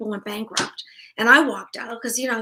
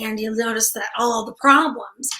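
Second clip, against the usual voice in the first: ordinary talk, nothing marked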